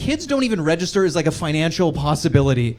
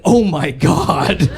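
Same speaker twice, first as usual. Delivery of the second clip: panicked brother; 4 dB louder, slower than usual. Retold or imitated.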